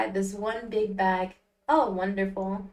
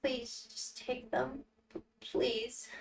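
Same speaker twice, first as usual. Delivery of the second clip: much softer, slow, pleading voice; timid Al; 10 dB quieter, much slower than usual. Acted. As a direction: beg it barely above a breath. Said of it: "I need to give him an actual voice".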